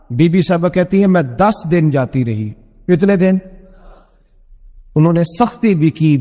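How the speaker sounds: ordinary delivery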